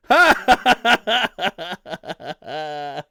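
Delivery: loud ringing laugh; hearty laugh. Real, not acted. Host reading a viewer's joke, laughing loudly.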